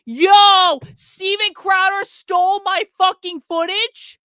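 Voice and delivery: stunned outraged yell. Real, not acted. Streamer discovering Crowder used his footage, shocked shout.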